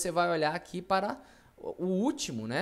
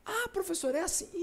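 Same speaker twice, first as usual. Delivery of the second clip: high mock student voice; teacher mimics a pupil, high and questioning; 13 semitones higher than usual. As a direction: play the eager student asking, pitched up.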